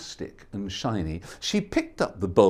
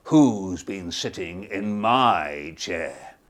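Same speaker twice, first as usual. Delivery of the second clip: Papa Bear; 7 semitones lower, slower than usual. Acted. Papa Bear discovering his porridge, slow booming. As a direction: boom it out slowly like a big bear. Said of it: "And Papa Bear said".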